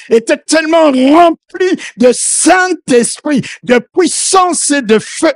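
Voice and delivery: loud, impassioned sermon delivery; fervent preacher calling out; preaching at full force. Acted. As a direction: preach it out to the whole church. Preacher shouting the anointing passage.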